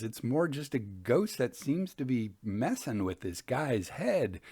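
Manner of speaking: ordinary delivery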